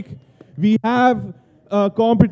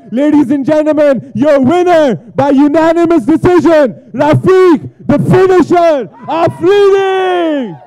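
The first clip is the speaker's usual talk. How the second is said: rising, louder announcer build; booming pumped announcer; 4 semitones higher, 4 dB louder than usual. Acted. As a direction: call the winner like a ring announcer. Interviewer announces winner.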